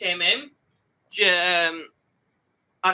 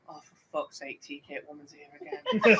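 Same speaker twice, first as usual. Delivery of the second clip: weary groan; 7 semitones lower, 5 dB quieter than usual. Retold or imitated. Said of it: "turned around to my supervisor and went".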